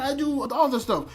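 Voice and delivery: ordinary talk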